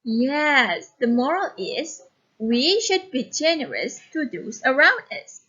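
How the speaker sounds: usual speaking voice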